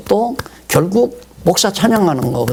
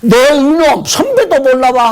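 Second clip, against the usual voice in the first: higher, louder scolding; defiant retort; 9 semitones higher, 4 dB louder than usual. Retold or imitated. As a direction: snap back at a junior. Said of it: "하고 대들면". (대드는 호통)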